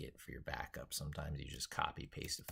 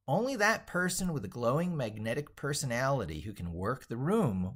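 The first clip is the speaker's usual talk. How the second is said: bright, emphatic book reading, higher and louder; animated reading aloud; 6 semitones higher, 5 dB louder than usual. Acted. Streamer reading his own book to viewers, lively read-aloud. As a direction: sell the book's pitch like an enthusiastic author.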